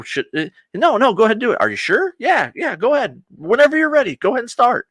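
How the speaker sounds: high, hurried, coaxing squeak; mock nervous go-ahead. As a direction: urge him on in a silly high voice. Quoted characters dither over attacking.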